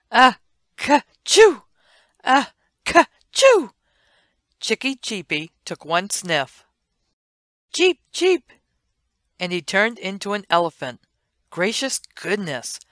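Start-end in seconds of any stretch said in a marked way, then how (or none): 0.1-3.7 huge high-pitched mock sneeze, loud; theatrical high sneeze, much louder; big sneeze. Elephant sniffs magic powder, sneezes. 7.7-8.5 high, loud chirping cheep cheep; squeaky baby chick call. Acted. Chick voice after sniffing.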